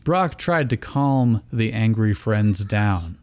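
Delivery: normal talking voice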